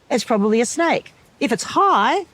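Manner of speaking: ordinary delivery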